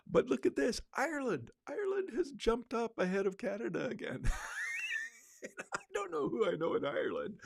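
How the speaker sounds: high-pitched, amazed and amused; delighted surprise. Real, not acted. Podcaster spots Ireland climbing his listener stats, delighted surprise. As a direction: marvel at it high up, breaking into a laugh.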